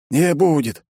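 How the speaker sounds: high-pitched, blunt denial; secretary's contradiction. A narrator's reading. Secretary refusing to fetch anything, high and unconcerned. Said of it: "ответил личный секретарь".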